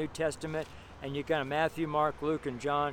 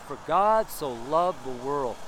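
slow reading; slower than usual. Shown as a demonstration. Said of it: "and just read it slowly".

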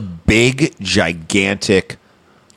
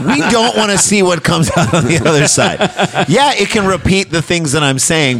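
laughing; 6 semitones higher than usual. Real, not acted. Laughing through a joke.

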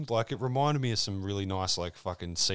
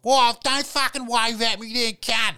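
put-on higher, louder, slow tough-kid yell; mock aggressive yell; 12 dB louder, slower than usual. A local kid yelling at a waving stranger, mock aggressive. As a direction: snarl it like a hostile street kid.

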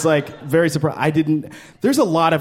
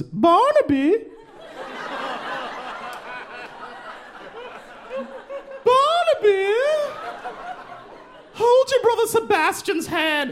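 high, shrill-free mock call of a fussy mom; posh Brooklyn mom calling; slower than usual. Mom calling her son across the playground, high mock call. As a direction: call the kid like an over-precious mother. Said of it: "the mom goes".

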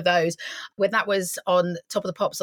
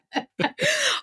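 high embarrassed giggle. Real, not acted. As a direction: laugh it off, sheepish and high. Embarrassed laugh after question.

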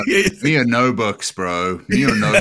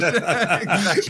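ordinary talk

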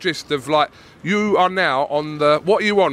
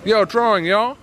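slightly raised mock Philly accent; Philly accent attempt; 4 semitones higher than usual. Shown as a demonstration. Repeating the learners' slang back, attempted Philly accent. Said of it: "I should do some of your Philly accent".

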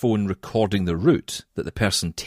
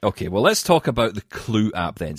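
normal talking voice

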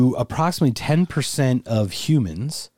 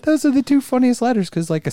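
laughing; 12 semitones higher, 3 dB louder than usual. Real, not acted. Laughing about P and C.

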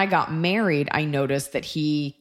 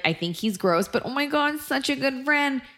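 mock woman; 9 semitones higher than usual. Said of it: "women will be like".

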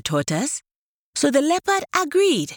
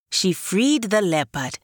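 ordinary delivery

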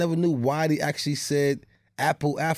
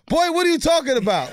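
mom scolding; 9 semitones higher, 4 dB louder than usual. Retold or imitated. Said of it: "So my mom like".

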